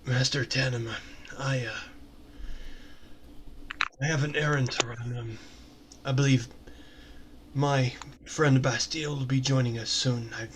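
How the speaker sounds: low, soft, hesitant character voice; Drayden, reserved and deferential. Acted. Drayden excuses himself to Master Tanema, low and hesitant. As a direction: excuse yourself quietly, with halting pauses.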